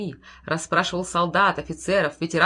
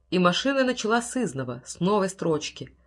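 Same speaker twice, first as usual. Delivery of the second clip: ordinary delivery, nothing marked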